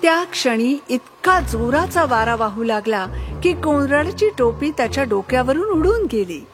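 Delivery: plain reading voice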